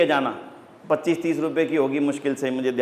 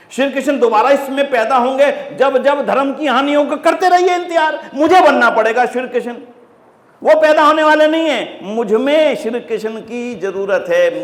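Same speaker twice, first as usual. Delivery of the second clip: high, loud, forceful preacher's exhortation; impassioned preaching; 8 semitones higher, 5 dB louder than usual. Real, not acted. Preacher urging listeners to become Krishna themselves, impassioned. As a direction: drive it home, pitched up and loud.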